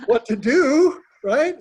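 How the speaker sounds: laughing while coaching Tanya about stocks. Real, not acted. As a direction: tease her through a laugh. Coach teasing through a laugh.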